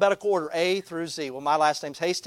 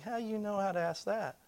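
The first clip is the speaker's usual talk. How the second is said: quiet, higher-pitched judge's query; suspicious judge; 4 semitones higher, 11 dB quieter than usual. Retold or imitated. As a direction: question softly, as a puzzled judge. Judge questions him, looking down.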